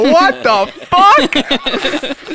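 drawn-out, high, loud what the fuck; stunned disbelief. Retold or imitated. Hearing Leo on the phone, a stunned high drawn-out cry. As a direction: let the shock stretch every word out. Stunned reaction to Leo's call.

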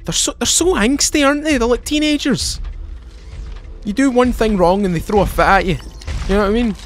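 high mocking voice. Real, not acted. Mocking the angsty enemies.

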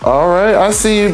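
ordinary talk, nothing marked

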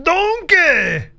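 high, loud shocked yelling; shocked outcry. Real, not acted. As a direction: cry out in disbelief at the sight. Shocked at Donkey again.

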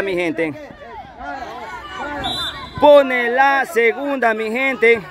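high, slow, savoring the goal; excited commentary. Real, not acted. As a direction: linger on every word of the goal. Celebración tras el gol. (narración alegre, aguda y pausada)

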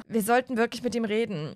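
ordinary talk, nothing marked

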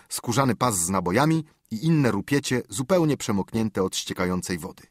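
usual speaking voice

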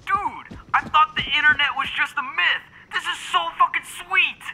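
high, amazed and thrilled; like a kid seeing magic; excited. Acted. Discovering the internet, thrilled.

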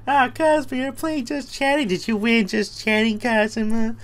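high put-on teasing voice; mock squeaky voice. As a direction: squeak it out like a cartoon.

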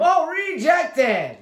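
high, drawn-out exclamation; excited block call. Real, not acted. Gamer breaking off his picks for a block, excited. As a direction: burst out with a long, high-pitched call.

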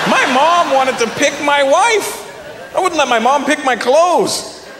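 high, slow, indignant protest; hammed-up disbelief, drawn out; mock outrage. Comedian protests mom picking his wife, high mock indignation.

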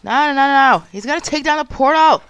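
loud, urgent protest; real loud gamer panic; alarmed shouting. Real, not acted. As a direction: yell in dismay as the game slips away. Portal about to be destroyed.

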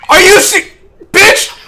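high, loud burst; outraged yell. Real, not acted. Gamer robbed of a kill, outraged yell. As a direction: break off and yell in disbelief.

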